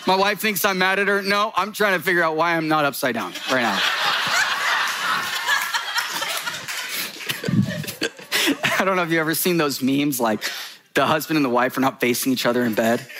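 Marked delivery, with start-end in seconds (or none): 3.8-8.1 breathless wheezing laugh, high and airless; big laugh. Real, not acted. Preacher cracking up at his own joke, wheezing laugh. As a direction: collapse into helpless silent laughter.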